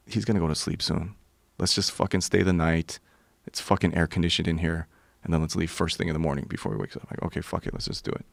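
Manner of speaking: low, quiet huddle talk; softly conspiring; hushed plotting. Acted. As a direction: keep it down, plotting on the sly. Huddled planning beside sleeping host.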